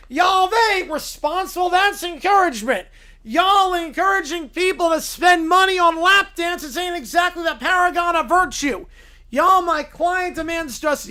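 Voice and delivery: loud, pompous Southern lawyer voice. Acted. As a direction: preach moral outrage to the judge like a Southern showman. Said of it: "Southern blue-haired lawyer".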